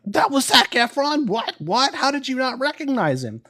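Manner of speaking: high-pitched imitation of stunned relatives; mock disbelief. Family learns he missed a star, mock-astonished. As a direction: squeal it as an incredulous family would.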